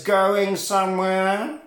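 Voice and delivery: slow, drawn-out impression of a film thug. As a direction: stretch it out in a mock villain voice. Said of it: "but he talks like that".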